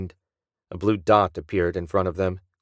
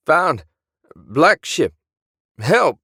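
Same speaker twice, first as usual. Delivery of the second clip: put-on high, loud, crackling cries for help in Willie's accent; Willie's garbled distress call; 7 semitones higher, 5 dB louder, much slower than usual. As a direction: shout it through a broken transmission, in Willie's accent. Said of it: "No one else says lads in an accent like that".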